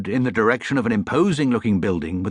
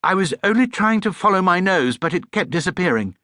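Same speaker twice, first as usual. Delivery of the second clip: higher, earnest little bear; lightly voiced Paddington, puzzled; small bear voice; 9 semitones higher than usual. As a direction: speak like a puzzled young bear.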